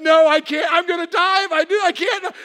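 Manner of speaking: loud, high-pitched inner panic; frantic retold self-argument; panicked thinking. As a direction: blurt it in acted desperation. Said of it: "I'm thinking".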